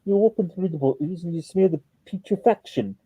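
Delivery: a light imitation of a posh British accent, slowing at the end; Stephen Fry's posh British accent. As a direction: savour each word in a posh British accent, lightly mimicked. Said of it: "posh and intelligent British accent".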